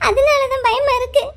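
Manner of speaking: slow, crying and frightened; girl crying that she is scared to go home; tearful fear. Acted. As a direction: whimper it out, close to tears. Frightened girl near tears.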